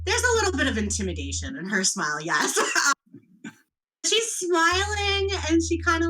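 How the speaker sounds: ordinary delivery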